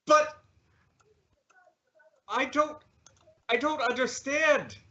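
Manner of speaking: hurt bewilderment. Acted. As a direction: falter between words, wounded and lost. Rejected suitor confused and hurt.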